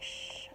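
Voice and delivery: soft, drawn-out "shh"; hushing shush. A narrator's reading. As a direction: hush them like a sneaking gorilla. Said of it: "And the gorilla's like".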